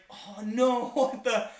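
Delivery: mock dismay. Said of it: "I was like".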